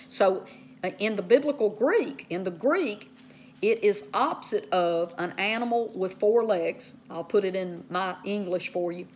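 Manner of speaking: ordinary talk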